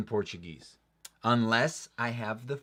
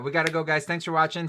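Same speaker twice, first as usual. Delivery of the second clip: cheery outro; 7 semitones higher, 5 dB louder, faster than usual. Real, not acted. Upbeat sign-off to viewers.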